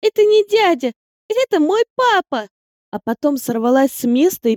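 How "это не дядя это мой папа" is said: put-on high, loud, proud little girl; a small girl announcing it loudly. A narrator's reading. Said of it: "громко заявила".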